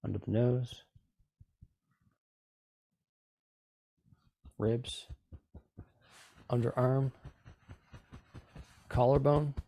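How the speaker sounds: calm, even meditation guide voice; guided meditation. Acted. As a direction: guide them through the tapping gently. Leads a tapping meditation.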